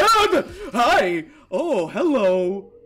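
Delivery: high, loud, slow mock-friendly hello; mock cheery greeting. Player mock-greets the creeping monster, loud and slow.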